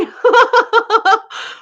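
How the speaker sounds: high, loud run of laughs; real laughter. Real, not acted. Amused by chat joke.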